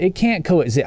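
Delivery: usual speaking voice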